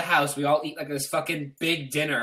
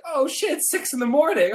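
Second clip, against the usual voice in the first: shocked realization; 13 semitones higher, 6 dB louder than usual. Retold or imitated. Realizing it's dawn.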